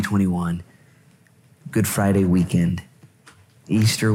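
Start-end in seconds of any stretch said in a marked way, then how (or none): none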